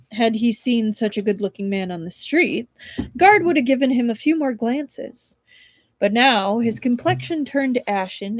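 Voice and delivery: plain reading voice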